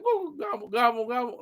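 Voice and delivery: high mock turkey gobble. Streamer imitates the turkey from the movie scene with a high gobble. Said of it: "he's like".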